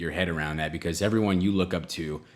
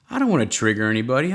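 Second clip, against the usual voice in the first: ordinary talk, nothing marked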